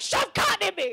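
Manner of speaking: high, loud bossy demand; demanding roommate. Acted. Vagina roommate ordering her around, loud demand. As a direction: shout it as an urgent order. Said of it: "And she's like".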